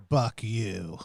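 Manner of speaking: quiet, low mock curse. Cassandra to the thing at her feet, low curse. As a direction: say it low and understated, for the character.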